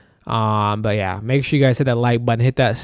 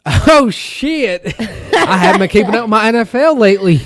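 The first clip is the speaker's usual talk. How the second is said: high laughing blurt, louder; 7 semitones higher, 5 dB louder than usual. Real, not acted. Laughing at co-host, laughing through words. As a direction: laughs at his own slip while talking. Caught out on football scores.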